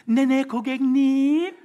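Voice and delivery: high, slow, sugary greeting; fake sweet service voice. Preacher mocking fake customer sweetness. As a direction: lay on the fake politeness. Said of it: "그건 가짜입니다".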